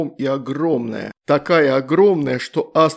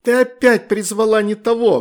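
higher and louder, shouting; shouting girl; 8 semitones higher, 6 dB louder than usual. A narrator's reading. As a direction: yell it at your sister. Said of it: "крикнула Астрид".

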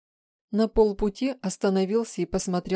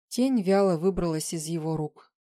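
ordinary delivery, nothing marked

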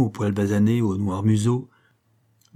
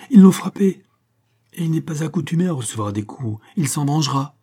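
high, loud outburst, then easing; alarmed exclamation; 5 semitones higher, 5 dB louder than usual. A narrator's reading. Shirley seeing her dog was struck, alarmed exclamation. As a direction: burst out in alarm. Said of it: "s'écria Shirley".

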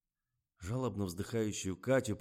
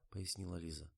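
usual speaking voice

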